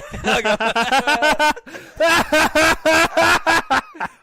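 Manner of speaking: big high burst of laughter; loud high laugh. Real, not acted. Host cracking up at his co-host's prediction, loud laugh. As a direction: crack up loudly at your own pick.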